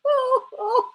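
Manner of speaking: high, thin voice full of laughter; crying with laughter. Real, not acted. Streamer trying to carry on, still crying with laughter. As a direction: struggle to talk through tearful real laughter. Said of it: "I'm crying now".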